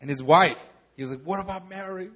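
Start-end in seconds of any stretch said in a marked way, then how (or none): 1.2-2.1 lightly voiced movie hero, soft; a softer first hint of the character's voice; George Bailey impression. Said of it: "He talks like this".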